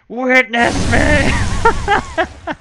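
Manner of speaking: slow, drawn-out loud creepy chant; creepy acolyte voice. Acted. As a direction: stretch it out like a creepy cultist. Voicing acolyte test line.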